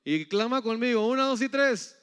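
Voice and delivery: high, loud call building to the count; rallying count-in. Real, not acted. Preacher cueing congregation to cry out, loud count-in. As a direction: count the crowd in at full voice.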